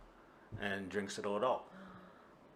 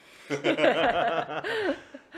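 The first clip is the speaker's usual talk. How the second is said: big burst of laughter; loud laugh; 11 dB louder than usual. Real, not acted. Laughing at the plan.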